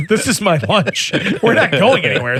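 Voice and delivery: laughing with a mouthful. Real, not acted. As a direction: laugh through the words. Eating on air, laughing.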